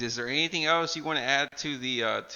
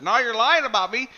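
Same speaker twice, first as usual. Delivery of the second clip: higher and louder, accusing; loud high mock accusation; 7 semitones higher, 7 dB louder than usual. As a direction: throw the line back as a mock accusation. Quoting Chris, high and loud.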